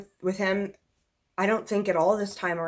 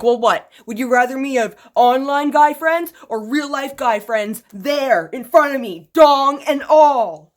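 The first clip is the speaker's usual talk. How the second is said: loud, slowing sarcastic retort; loud sassy comeback, slowing at the end; imagined comeback; 5 semitones higher, 11 dB louder, slower than usual. Retold or imitated. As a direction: fire back at him, building louder. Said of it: "I'm gonna be like".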